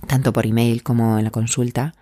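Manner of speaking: usual speaking voice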